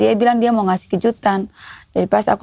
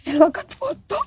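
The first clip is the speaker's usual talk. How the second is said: telling it while close to crying; tearful; 5 semitones higher than usual. Real, not acted. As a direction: voice thick with tears, holding back sobs. Mother recounting hardship, crying. (suara menahan tangis)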